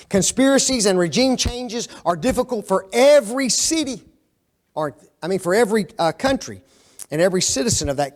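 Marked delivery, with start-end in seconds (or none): none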